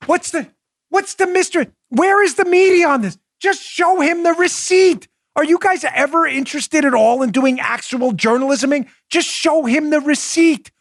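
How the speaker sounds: high-pitched indignant appeal; worked-up, incredulous and high; exasperated demand. Real, not acted. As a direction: demand answers in sheer disbelief.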